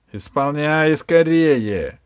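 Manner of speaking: громкий медленный приказ; поторопить, выкрикнув приказ; крик. Acted. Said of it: "и крикнул". (клоп из шкафа кричит палачу, громко торопя)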